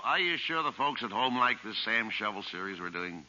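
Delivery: normal talking voice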